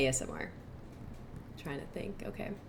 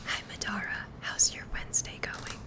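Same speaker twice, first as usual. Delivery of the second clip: whispering. Real, not acted. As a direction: whisper gently for the ASMR stream. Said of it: "so I have to whisper now".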